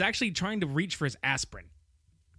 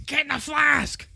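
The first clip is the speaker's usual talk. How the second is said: higher, louder shouted order; mock shout; 9 semitones higher, 6 dB louder than usual. Fran commanding Little Nicky into the flask, mock shout. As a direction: bark the order at full volume.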